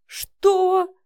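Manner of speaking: high, loud cry of disbelief; stunned outburst; shocked. A narrator's reading. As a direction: blurt it out, appalled. Shocked by the mocking story.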